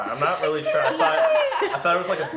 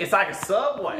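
higher-pitched put-on southern accent; hammed-up southern accent; 6 semitones higher, 3 dB louder than usual. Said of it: "doing a southern accent".

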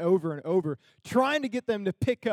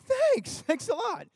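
much higher, sarcastic thanks a lot; slower than usual. Retold or imitated. Answering the boss who said they stink, with high sarcastic thanks. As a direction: toss back a mock-grateful thanks, way up high. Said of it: "I was like".

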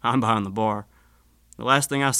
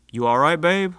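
concerned call; 6 semitones higher, 6 dB louder than usual. A narrator's reading. Husband checks on wife.

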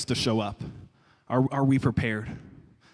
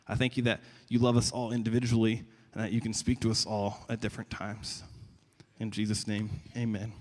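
soft, hushed pastoral prayer; 4 dB quieter than usual. Real, not acted. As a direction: pray gently, sinking softer toward the amen. Prays aloud for congregation.

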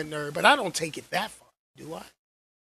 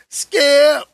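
loud high call; shouting Skip; 7 semitones higher, 13 dB louder than usual. Pretend. Shannon Sharpe bit calling his co-host, shouting. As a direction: holler it across the studio.

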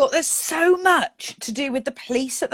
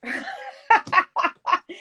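high laugh; 12 semitones higher than usual. Real, not acted. Laughing at the Sharon photo.

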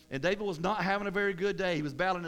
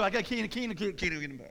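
fudge the answer in a garbled mumble; evasive mumble; faster than usual. Acted. Churchgoer dodging "what church you go?", evasive mumble.

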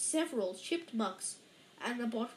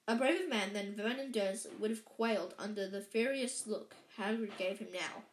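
plain reading voice

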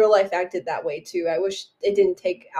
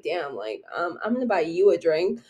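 lightly voiced guy; 5 semitones lower, 5 dB quieter than usual. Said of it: "and was like".

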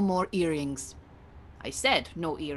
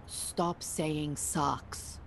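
very slow, every word weighed, a softer plea; slow pleading insistence; 3 dB quieter, much slower than usual. Acted. Yegane begs Debs to stop swearing, slow and pleading. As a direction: drag out stop saying sucks as a begging order. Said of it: "I am begging you with all my spirit".

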